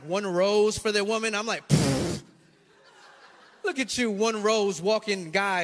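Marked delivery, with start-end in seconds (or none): 1.7-2.2 dismissive lip-burst scoff; scornful pfft. Retold or imitated. Husband scoffing at men carrying one rose, dismissive pfft.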